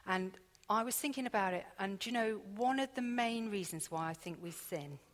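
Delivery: usual speaking voice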